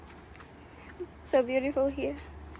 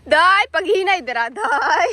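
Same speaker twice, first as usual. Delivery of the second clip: calling out; 3 semitones higher, 7 dB louder than usual. Real, not acted. Mother calls to child on slide.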